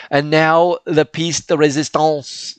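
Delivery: hammy mock French accent, slowed; a deliberately bad French accent on the phrase; terrible French accent. To viewers, unveiling the base in a mock French accent. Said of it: "that's my terrible, terrible French accent".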